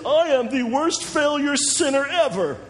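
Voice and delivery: high, slow hammed-up self-condemnation; mock confession. Preacher mocks believers confessing they are failures, high and slow. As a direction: overdo the gloomy confession.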